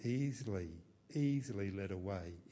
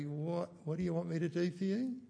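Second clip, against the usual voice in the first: a raised, wary questioning pitch; suspicious; 7 semitones higher than usual. Retold or imitated. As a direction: ask it with narrowed suspicion. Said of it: "He's not suspicious of their, of their request".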